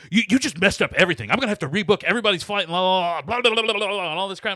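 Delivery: high, loud, fast mock rant; angry rant. Travel agent ranting at caller, loud and fast. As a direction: rattle off the complaint angrily. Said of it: "going off on me on the phone".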